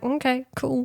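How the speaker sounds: soft, offhand imitated reply; mock nonchalant. Singer giving in to the waiting woman, mock nonchalant. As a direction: imitate someone backing off casually. Retelling his indifferent reply.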